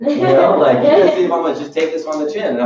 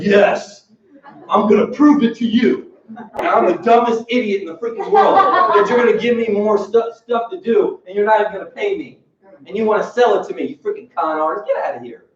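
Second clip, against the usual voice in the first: sarcastic mock reply; 7 semitones higher than usual. Sarcastic imagined reply, laughing.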